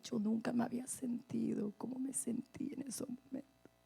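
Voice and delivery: breathless awed whisper. Real, not acted. Telling the congregation her deepest feeling, whispered. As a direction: whisper it like a secret. Intimate climax of testimony.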